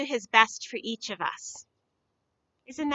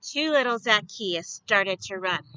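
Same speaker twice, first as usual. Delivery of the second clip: ordinary delivery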